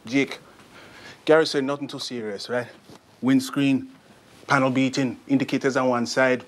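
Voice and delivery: put-on brisk Jamaican-accented report; easy, matter-of-fact Jamaican voice. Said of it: "I can do a Jamaican accent".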